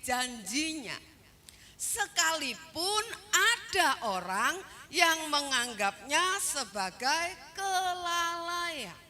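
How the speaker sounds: deliberate, emphatic sermon delivery; emphatic preaching. Acted. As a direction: stretch the key words of the verse. Preacher expounds verse emphatically.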